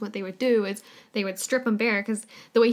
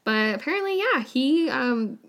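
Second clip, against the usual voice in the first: normal talking voice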